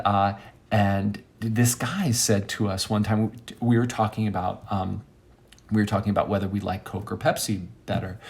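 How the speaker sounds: ordinary talk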